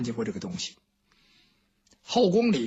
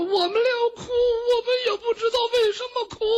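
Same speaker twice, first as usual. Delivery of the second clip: very high, quick blubbering reply; comic sobbing; faster than usual. Acted. Maids inside answer they don't know why they cry, blubbering high. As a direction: wail it out fast and high, comically lost. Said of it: "他们在那抽抽搭搭的哭".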